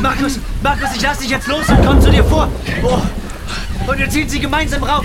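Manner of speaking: high, loud urgent yell; urgent shout. Acted. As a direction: shout the plan over the storm. Rescue on a cliff edge.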